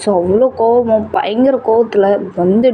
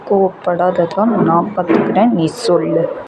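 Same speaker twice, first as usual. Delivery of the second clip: normal talking voice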